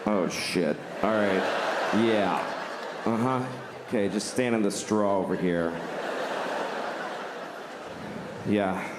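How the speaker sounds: low, laid-back zoo worker; low zookeeper voice. Acted. Acted zookeeper scene.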